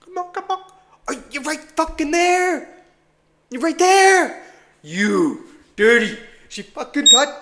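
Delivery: high, loud urging; will the price to touch; excited pleading. Real, not acted. Trader urging the price to his order, excited pleading.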